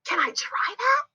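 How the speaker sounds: high-pitched, eager question; excited little character keen to have a go. Acted. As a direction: ask eagerly in a small, high voice. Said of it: "Z asked excitedly".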